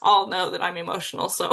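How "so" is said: normal talking voice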